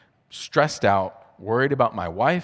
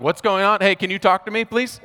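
much higher and louder, pleading for an answer; frantic high plea; 10 semitones higher, 4 dB louder than usual. Retold or imitated. New dad begging rushing nurses for answers, panicked high plea. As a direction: voice jumps up in retold panic.

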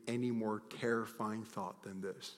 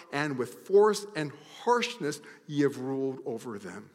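forceful preaching; 6 semitones higher, 9 dB louder than usual. Acted. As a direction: thunder God's rebuke at the shepherds. Preacher reading God's rebuke aloud.

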